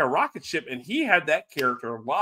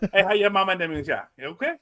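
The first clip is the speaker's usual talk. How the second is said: heavy Cajun, asking after his mama; Cajun accent; 3 semitones higher, faster than usual. Retold or imitated. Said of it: "I can't even understand what the fuck you're saying".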